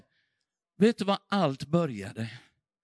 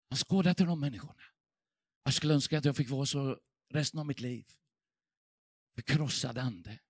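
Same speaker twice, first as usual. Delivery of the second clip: a tender, softer aside, spoken fast; hushed and quick; 4 semitones lower, 7 dB quieter, faster than usual. Real, not acted. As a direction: drop much softer and hurry through it, almost to yourself. Quiet reflection on broken spirit.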